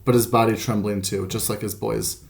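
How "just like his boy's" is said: ordinary delivery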